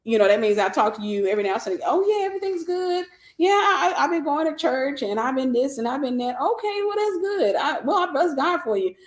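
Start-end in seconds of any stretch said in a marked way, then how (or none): 1.9-6.4 high, breezy mock voice of a parent; lightly hammed-up upbeat parent; parent's phone chatter. 6.4-8.9 high, mock-polite replies; a light put-on polite voice; polite phone reply.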